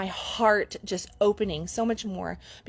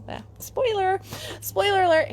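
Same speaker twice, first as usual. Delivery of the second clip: teasing spoiler alert; 10 semitones higher, 4 dB louder than usual. Real, not acted. Teasing announcement to viewers.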